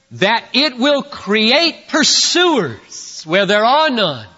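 forceful preaching. Real, not acted. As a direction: drive each phrase home to the congregation.

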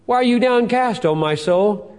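high, loud question flung at his soul; psalmist's cry. Acted. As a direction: demand it of your own soul. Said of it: "he asks".

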